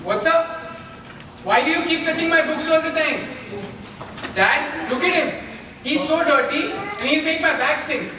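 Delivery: high, indignant complaining; angry whine. Acted. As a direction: whine to dad in disgust. Boy complaining about servant.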